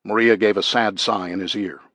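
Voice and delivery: ordinary delivery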